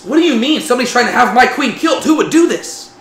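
the king bristling at the threat to his queen; retold royal outrage; furious king. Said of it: "And he's furious".

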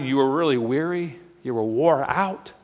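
normal talking voice

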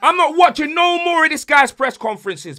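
loud, high, fed-up shout; angry shout. Real, not acted. Fan yelling to his mate about the manager's press conferences, loud and fed up. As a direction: yell it like you've had enough.

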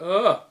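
high, loud mock yelp; sudden loud "ah" of alarm; acted high-pitched cry. Acts a cry at attack.

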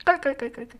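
a soft, teasing spelt-out cackle, held back; quiet put-on 깔깔 laugh; mock cackle. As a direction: giggle the syllables out mockingly. Reading a comment's spelt cackle.

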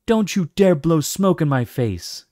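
high, loud furious snap; angry shout. Acted. Dragon yelling at another who blew smoke in her face, angry shout. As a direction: spit it at whoever blew the smoke.

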